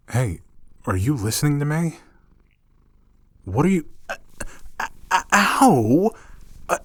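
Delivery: high, loud, stammering and yelping; flustered yelps. Acted. While the catgirl kneads their chest, flustered high yelping. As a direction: squeak out protests between ows.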